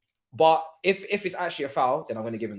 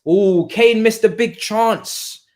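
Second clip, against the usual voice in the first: excited; 4 semitones higher, 8 dB louder, much slower than usual. Real, not acted. Reacting to a missed chance.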